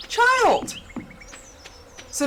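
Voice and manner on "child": put-on much higher exasperated shout of Child; exasperated parent. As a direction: call her out like a fed-up mom. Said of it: "I'm like".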